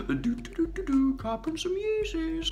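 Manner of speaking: high, soft, slow singsong mockery; airy mock tune of a casual buyer. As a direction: hum it like a clueless buyer.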